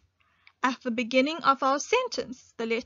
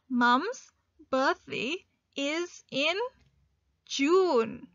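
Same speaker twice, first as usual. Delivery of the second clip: slow, word by word; slow dictation read; much slower than usual. Shown as a demonstration. Teacher dictating a sentence for learners to write, slow. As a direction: dictate it slowly for writing. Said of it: "continue with writing our sentence".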